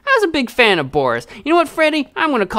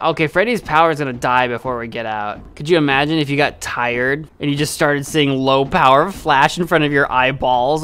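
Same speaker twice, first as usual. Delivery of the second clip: usual speaking voice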